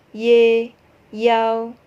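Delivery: "ye" and "yao" each glided as one syllable; correct Mandarin syllables. Shown as a demonstration. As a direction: model the y-glide cleanly. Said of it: "bukan iya". (lafal benar satu suku kata)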